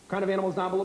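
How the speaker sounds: rapid-fire quiz question; breakneck pace. Shown as a demonstration. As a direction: fire the question off fast, teacher drilling a class. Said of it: "moving at this breakneck speed".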